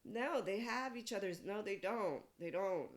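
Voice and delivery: loud, high protest that comes down in pitch; heated contradiction. Acted. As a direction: argue back loudly, cutting in. Acted argument contradicting a claim.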